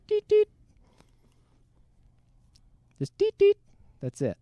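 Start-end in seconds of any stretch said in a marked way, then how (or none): 0.1-0.5 two high-pitched electronic beeps; imitated watch beep. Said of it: "we just hear". 3.2-3.6 two high-pitched electronic beeps; imitated watch beep. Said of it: "Just that little sound".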